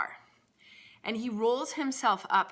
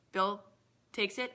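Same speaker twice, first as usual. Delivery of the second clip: ordinary talk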